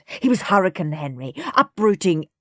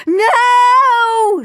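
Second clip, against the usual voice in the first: long, high, loud scream; 9 dB louder than usual. A narrator's reading. Both brothers refusing to hand Mum the toy, screaming. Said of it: "screamed Henry and Peter".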